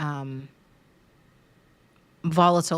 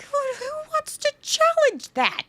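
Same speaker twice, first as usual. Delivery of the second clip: much higher, mock-indignant squeak; defensive scoff. To her therapist, mock-indignant high-pitched defensiveness. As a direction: play the offended client brushing off the challenge. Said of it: "And you're like".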